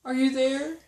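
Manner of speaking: calling. A narrator's reading. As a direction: call out hoping for an answer. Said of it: "and called".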